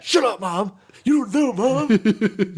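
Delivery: very high, loud mock tantrum; bratty yelling. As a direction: yell like a hammed-up bratty son. Acts son yelling at mom.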